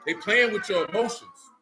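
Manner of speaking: usual speaking voice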